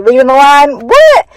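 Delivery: high squeaky what, loud; mock-amazed at how big it is; excited disbelief. Real, not acted. Teacher to viewers, gushing about leading the line, excited.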